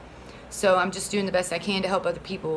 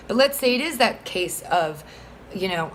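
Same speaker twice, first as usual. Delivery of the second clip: ordinary talk, nothing marked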